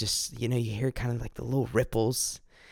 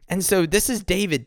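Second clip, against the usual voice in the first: ordinary delivery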